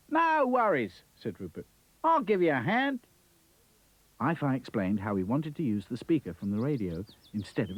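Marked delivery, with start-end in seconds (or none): 0.1-3.0 high, bouncy Aussie voice; a cheery kangaroo exclaiming; Australian kangaroo. Acted. Kangaroo Rupert's voice.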